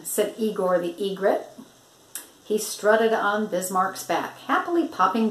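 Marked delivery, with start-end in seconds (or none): none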